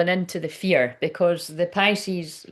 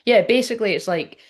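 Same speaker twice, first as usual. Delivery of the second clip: ordinary talk, nothing marked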